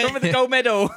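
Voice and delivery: laughing mock officer. Joking playing the officer.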